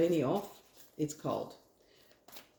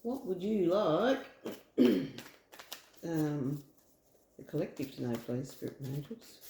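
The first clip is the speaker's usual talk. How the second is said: invocation; 3 semitones lower, slower than usual. Acted. Calls on spirit before drawing.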